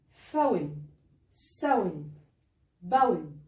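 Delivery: each word with an ow diphthong. Shown as a demonstration. Teacher reading ow words for the class. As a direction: model the ow glide in each word. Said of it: "فهو بينطق".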